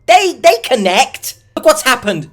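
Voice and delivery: high, loud incredulous outburst; exasperated disbelief. Acted. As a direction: burst out in mocking disbelief. Exasperated rant at victim's belief.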